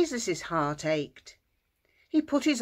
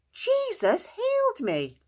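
very high, slow, drawn-out shout; exultant shouting; 4 dB louder, slower than usual. Acted. Healed man proclaiming to the town, shouting exultantly. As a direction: stretch it out at the top of the voice. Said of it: "he ran into town shouting".

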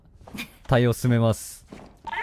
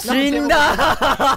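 laughing mock wail; 12 semitones higher, 4 dB louder, much slower than usual. Playful mock death wail.

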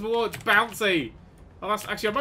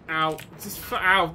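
usual speaking voice

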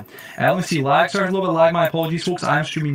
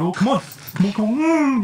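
frustrated; 10 semitones higher, 5 dB louder than usual. Real, not acted. Fighting zombies.

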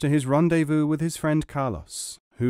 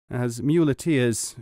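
ordinary delivery

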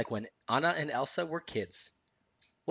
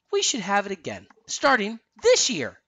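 higher voice, slowing on the last words; excited proposal; 6 semitones higher, 3 dB louder than usual. A narrator's reading. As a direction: build to an eager announcement. Anna proposing to revive parade.

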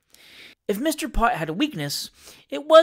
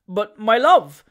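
higher, louder humble protest; great humility; 4 semitones higher, 8 dB louder, slower than usual. A narrator's reading. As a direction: plead humbly with a raised voice. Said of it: "said Mister Pott with great humility".

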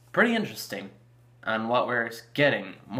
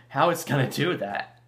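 usual speaking voice

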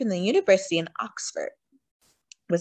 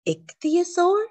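puzzled question; 10 semitones higher, slower than usual. Acted. Mary repeats strange word.

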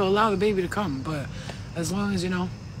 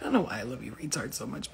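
normal talking voice

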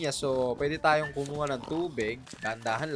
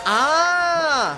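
a sudden loud high "Ah!" of surprise; surprised cry; 9 semitones higher, 10 dB louder than usual. Real, not acted. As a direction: yelp as something goes wrong. (gulat na sigaw)

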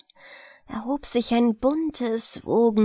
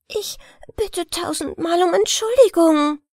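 startled apology; 7 semitones higher, 5 dB louder than usual. A narrator's reading. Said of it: "sagte das erschrockene Wichtelinchen".

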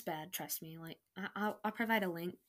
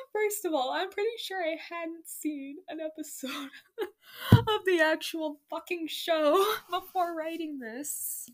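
laughing; 7 semitones higher, 5 dB louder than usual. Real, not acted. Still laughing.

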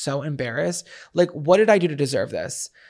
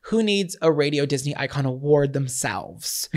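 normal talking voice

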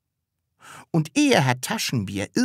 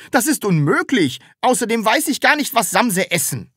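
high, raised protest; alarmed refusal; 11 semitones higher, 4 dB louder than usual. A narrator's reading. Taschenbier refusing to take in the Sams, alarmed. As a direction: object in a flustered rush. Horrified man refusing the demand.

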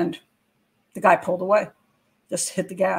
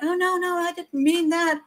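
flustered apology; 7 semitones higher than usual. Retold or imitated. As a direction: backpedal in a high, timid voice. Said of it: "And then you're like".

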